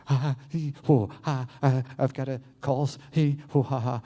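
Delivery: acted anxious panting; anxious part. Voicing the anxious part for the room, anxious. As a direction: fret in broken, breathy bursts. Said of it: "Who's my anxious parts?".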